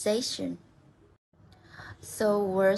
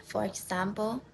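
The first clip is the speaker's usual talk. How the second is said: normal talking voice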